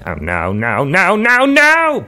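cartoon panic building to a high shout; Cleveland. Guest plays cartoon man falling in bathtub, building shout. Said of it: "he's falling down".

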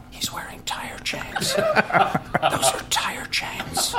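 slow, hushed stage whisper; audience whisper. Retold or imitated. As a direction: whisper it in disbelief like a spectator. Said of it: "men saying to their wives".

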